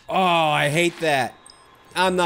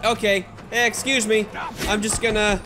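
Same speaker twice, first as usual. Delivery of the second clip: panicked; 8 semitones higher than usual. Real, not acted. Found by enemies, fleeing.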